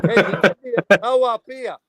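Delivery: calling out loud and high for a tow; loud mock call for help. Stranded driver calls loudly to a passing motorist. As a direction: shout it up the road, playing the stranded driver.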